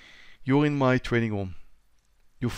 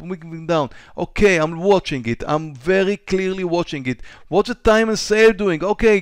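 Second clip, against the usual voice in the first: tense, excited trader losing money, louder and higher; dramatic; 4 semitones higher, 5 dB louder than usual. Shown as a demonstration. Trading teacher acting out his own nerves, dramatic and louder. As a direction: act out the panic of a trader glued to the screen. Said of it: "Excuse me for being a little bit dramatic here".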